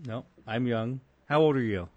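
ordinary talk, nothing marked

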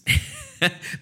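sudden high cackling laugh; high cackle. Real, not acted. Laughing at a chat comment about haters, high cackle. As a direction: let out a big high cackle.

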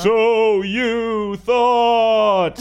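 "So you thought" drawn out very slow, high and loud; dramatic echo voice. Shown as a demonstration. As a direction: stretch "So you thought" into a slow booming trailer line. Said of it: "put some echo on that".

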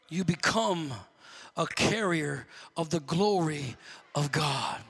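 ordinary talk, nothing marked

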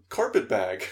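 loud, upbeat nonsense reply; imitated upbeat gibberish. Co-host imitating the alien's nonsense answer, upbeat gibberish. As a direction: toss it out brightly, oblivious to threats. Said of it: "he has a very upbeat to-, a tone of voice".

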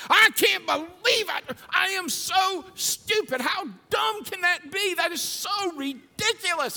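exasperated yell at his own mistake; self-directed angry outburst. Retold or imitated. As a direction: berate yourself at full volume. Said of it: "and I'll, I'll just".

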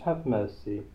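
ordinary talk, nothing marked

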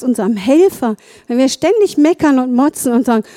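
usual speaking voice

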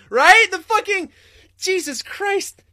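high shrieking disgust, drawn out. Real, not acted. Streamer shrieking in disgust at the customer. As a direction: squeal in horror at the gross order.

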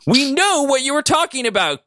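very high, exasperated cry. A narrator's reading. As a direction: cry out at a squealing pitch. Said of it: "Katie cried out".

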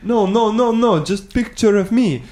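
high, slow mock Eastern European accent; bad Eastern European-sounding accent. Stranger refusing a selfie together, bad Eastern European accent. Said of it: "forget the accent".

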